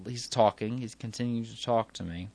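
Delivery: ordinary delivery, nothing marked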